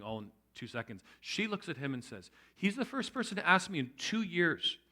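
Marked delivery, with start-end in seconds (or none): 2.6-4.8 higher, louder retold protest; emphatic retort. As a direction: imitate her standing up for him, pitched up. Woman defends him through tears.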